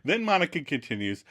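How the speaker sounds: ordinary talk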